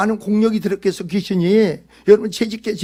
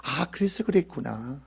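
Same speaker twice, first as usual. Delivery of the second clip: low, slow, soft musing; soft, drawn-out "ah, so that's why"; dawning realization; 6 semitones lower, 10 dB quieter, slower than usual. Acted. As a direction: realize it quietly to yourself. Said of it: "아, 그때 알아".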